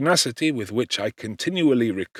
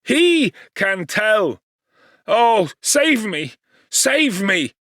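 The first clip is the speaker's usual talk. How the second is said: high, loud, slow terrified plea; terrified pleading; 10 semitones higher, 5 dB louder, much slower than usual. A narrator's reading. Victor begs to be saved from the monster, high and panicked. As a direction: beg for rescue in panic. Victor raving, seized by terror.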